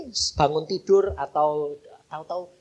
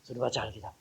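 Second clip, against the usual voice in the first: hushed inner prayer; 4 semitones lower, 12 dB quieter, much faster than usual. Retold or imitated. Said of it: "Dalam hati itu saya ngomong".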